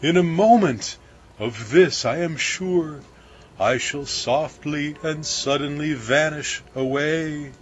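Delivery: mild fearful foreboding; baker's dread. A narrator's reading. Baker confessing his dread to crew, mildly anxious.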